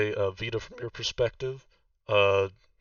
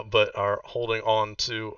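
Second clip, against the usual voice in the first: normal talking voice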